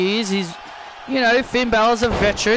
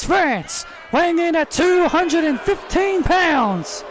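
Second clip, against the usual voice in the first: booming ring announcer intro; 4 semitones higher than usual. Acted. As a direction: announce him to the whole arena.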